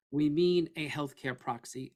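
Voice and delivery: ordinary delivery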